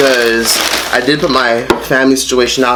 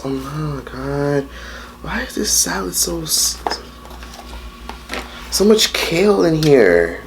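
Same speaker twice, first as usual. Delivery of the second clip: exasperated mutter; 4 semitones higher, 6 dB quieter, slower than usual. Real, not acted. Disappointed by her salad.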